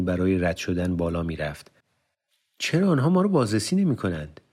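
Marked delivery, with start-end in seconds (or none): 2.6-4.4 much higher, astonished question; surprised. A narrator's reading. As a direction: blurt it out in amazement. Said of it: "با تعجب و با صدای بلند گفت". (با تعجب و صدای بلند)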